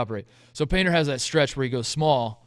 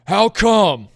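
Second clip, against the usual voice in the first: loud, high mock indignation; hammed-up angry demand for answers; outraged fans; 7 semitones higher, 11 dB louder than usual. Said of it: "all the Purdue fans on Twitter".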